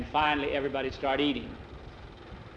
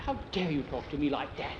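hushed indignation; 5 dB quieter than usual. Acted. Boy retorts to teasing friend.

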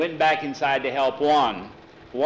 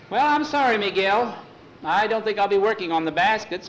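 mock apology; 6 semitones higher than usual. Refusing, pretending to walk away.

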